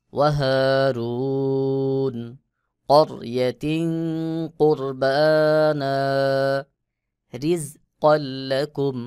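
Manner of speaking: slow, sung recitation of the verse; Quran recitation. Shown as a demonstration. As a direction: chant the verse with long held vowels.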